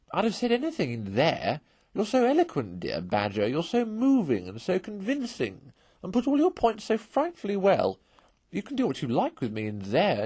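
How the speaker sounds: butter up the Badger with a sneer; mocking flattery. A narrator's reading.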